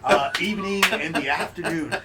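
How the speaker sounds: lavish the praise, hammed up; gushing praise. Tavern keeper gushing over goat impression.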